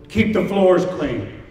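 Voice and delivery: ordinary talk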